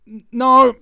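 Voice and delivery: very loud, high outburst; emphatic refusal. Real, not acted. Vlogger rejecting the Hollywood idea of heroes, emphatic. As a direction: slam the idea down loudly.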